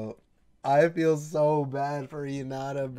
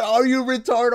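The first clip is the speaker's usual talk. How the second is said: mock yell; 10 semitones higher, 7 dB louder than usual. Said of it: "He said".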